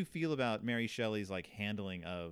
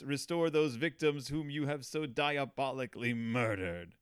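hammed-up outrage; 8 semitones higher, 4 dB louder than usual. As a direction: declaim it like a wronged Romantic hero. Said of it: "Frankenstein does most of the yelling".

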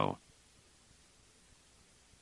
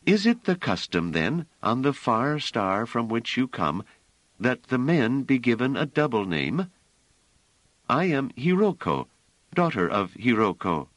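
clear soprano; 7 semitones higher than usual. A narrator's reading. Hiroko answering the strangers, clear high soprano. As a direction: sing it out lightly like a soprano.

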